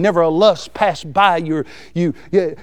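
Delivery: ordinary delivery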